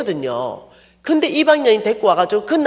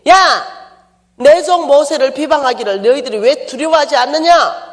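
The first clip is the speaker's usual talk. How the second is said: loud, higher, indignant rebuke; angry divine rebuke; 4 semitones higher, 4 dB louder than usual. Retold or imitated. Preacher voicing God rebuking Miriam and Aaron, angry shout. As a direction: shout down at them like an outraged God. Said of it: "그러니까 하나님이".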